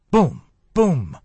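sharp, loud gunshot sounds; loud gunshot booms. A narrator's reading. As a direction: punch each boom out loud. Gunshots in the story.

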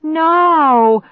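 high, loud, drawn-out yell; desperate shout. A narrator's reading. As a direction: scream it after a departing car. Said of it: "I shouted as I reached".

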